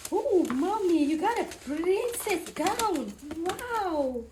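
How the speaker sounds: playful imitation of an awed son; imitating son. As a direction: gush like a little boy admiring mommy, drawing words out. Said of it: "Just like my son will say".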